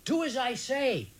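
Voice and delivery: high, drawn-out call without real volume; restrained narrator's yell; yelled back over shoulder. A narrator's reading. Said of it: "I yelled back over my shoulder".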